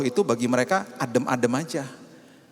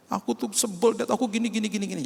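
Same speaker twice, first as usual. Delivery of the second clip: lightly voiced higher child's whine; upset daughter; 8 semitones higher than usual. Daughter complaining to her dad, a hint of whining. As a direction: whine like a sulky little girl.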